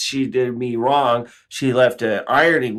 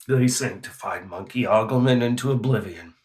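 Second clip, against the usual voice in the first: hushed solemn sermon; 4 semitones lower, 6 dB quieter than usual. Acted. Solemn mock prophecy.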